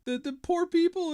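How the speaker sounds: high, whiny mock sob voice; a hammed-up fake tearful whimper; mock crying. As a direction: mimic a grown man weeping over the poor. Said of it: "he physically is crying".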